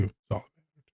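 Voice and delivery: quiet afterthought echo; soft repeat. Shown as a demonstration. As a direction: drop it to a murmur.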